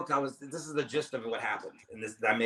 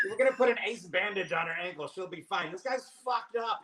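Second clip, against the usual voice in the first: mock bystanders; 9 semitones higher than usual. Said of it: "we're like".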